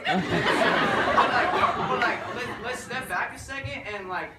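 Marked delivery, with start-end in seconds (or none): none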